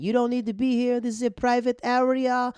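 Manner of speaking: high, loud imitation of a drunk heckler. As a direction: yell it like an irate woman heckling a show. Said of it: "She's heckling a comedian".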